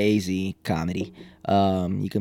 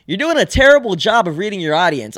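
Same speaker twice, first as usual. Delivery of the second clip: higher, louder scolding quote; retold heckler's complaint; 7 semitones higher, 5 dB louder than usual. Big lady scolds comic from the crowd, retold complaint. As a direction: call him out as an unimpressed audience member. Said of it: "And she was like".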